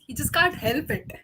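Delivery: ordinary talk, nothing marked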